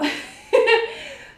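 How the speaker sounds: high, loud giggling laugh; burst of laughter. Real, not acted. Laughing at her terrible intro, high loud giggle. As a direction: crack up at yourself.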